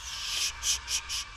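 whispered shushing, keep it silent; hushing whisper. Real, not acted. Player hushes himself while sneaking past the monster, whispered. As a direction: shush under your breath.

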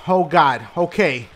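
ordinary delivery

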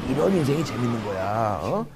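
ordinary delivery

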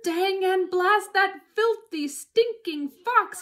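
high, loud, drawn-out outburst; angry cursing. A narrator's reading. Boggis cursing the fox, loud high anger. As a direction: curse slowly at full voice. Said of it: "Boggis came waddling up".

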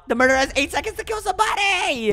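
high-pitched excited warning; high announcement. Pretend. Warning players of the murderer, high-pitched announcement. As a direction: call it out high, drawing attention. Game host announcing danger.

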